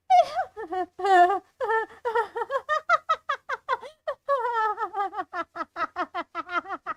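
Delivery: long, high villain cackle; maniacal laugh. Acted. Monster Claire mocks Hunter's poor throw, long maniacal laugh. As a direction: cackle madly, rising then tailing off. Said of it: "she starts laughing maniacally".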